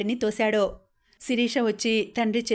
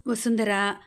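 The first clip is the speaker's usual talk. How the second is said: ordinary delivery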